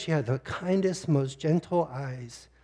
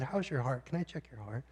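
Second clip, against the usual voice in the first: softer, hushed, caring question; tender doctor; 2 semitones lower, 13 dB quieter than usual. Retold or imitated. Doctor asking a tearful patient about her heart, hushed and tender. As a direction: ask gently, as if not to startle. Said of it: "she was so tender and kind".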